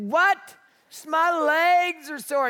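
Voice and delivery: high, slow mocking echo of a child; incredulous mimicking. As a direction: parrot the complaint in disbelief. Dad incredulously parrots the complaint.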